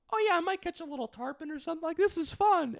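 high, airy carefree put-on voice; naive mock voice. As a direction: play your clueless past self. Mocking his naive past self.

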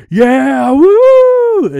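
high, loud whooping rally crowd; crowd cheer. Retold or imitated. Crowd cheers Trump line.

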